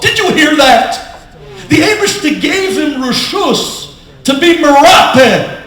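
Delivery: loud, high, impassioned proclamation; emphatic preaching. Real, not acted. Preacher challenging his audience about false despair, emphatic. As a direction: thunder the point at the congregation.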